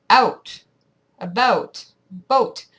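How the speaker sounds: slow Canadian "out", "about", "boat", dipping under the vowel; Canadian accent. Shown as a demonstration. Vlogger demonstrating the Canadian side, Canadian accent. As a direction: say each word apart with Canadian vowels. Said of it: "your accent goes under the vowel".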